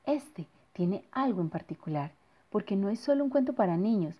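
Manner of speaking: plain reading voice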